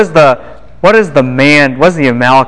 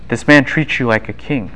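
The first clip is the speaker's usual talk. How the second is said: ordinary talk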